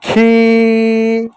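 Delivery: "खीर" stretched very slowly with a held ee; long drawn word. Shown as a demonstration. Teacher drawing out the word for children to repeat. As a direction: hold "खीर" out long and even. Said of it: "बोलो".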